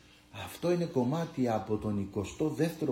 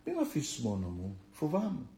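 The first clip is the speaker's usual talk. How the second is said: softer, pleading, as if alone in the room; hushed pleading prayer; 7 dB quieter than usual. Pretend. A frightened believer begging God not to leave, hushed. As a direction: whisper your complaint to God, afraid. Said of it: "Πες του".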